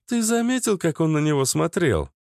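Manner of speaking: teasing young woman, lightly mocking; high teasing female voice. A narrator's reading. Liana teasing the gnome.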